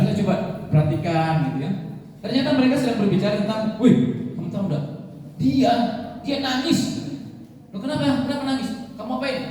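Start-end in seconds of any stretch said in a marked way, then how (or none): 3.8-6.8 high, eager schoolkid chatter; a kid excitedly telling a friend; excited child gossip. Retold or imitated. Kid tells classmate about crying boy, high and eager. 7.7-9.4 put-on high, hushed nosy kid; curious child reply. Classmate asks what happened, high and hushed. Children gossip voiced in sermon.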